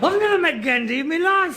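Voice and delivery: high-pitched, exasperated disbelief; incredulous protest. Acted. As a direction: protest at the absurdity of it. Protests absurd message.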